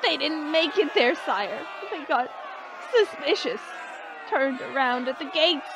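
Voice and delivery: high, anxious voice; jittery high-pitched messenger; nervous report. Acted. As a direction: explain the bad news fearfully. Frightened messenger explains to his master.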